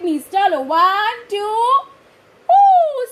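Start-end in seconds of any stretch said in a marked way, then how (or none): 2.5-3.1 high, loud delighted ooh; high loud thrilled ooh; excited ooh. Acted. Excited about sledging.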